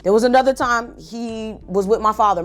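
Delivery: ordinary talk, nothing marked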